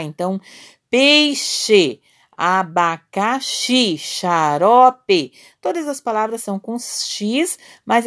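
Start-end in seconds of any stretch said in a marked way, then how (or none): 0.9-5.3 "peixe, abacaxi, xarope" drawn out, louder, each x said as sh; sound demonstration. Shown as a demonstration. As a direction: dictate "peixe, abacaxi, xarope" slowly in syllables, showing the sh sound. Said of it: "o som é da letra C H". ("peixe, abacaxi, xarope" pausado, destacando o som de CH)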